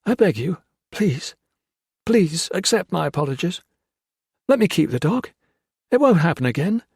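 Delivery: abject pleading. A narrator's reading. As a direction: grovel for the dog's life. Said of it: "Hawkins said abjectly".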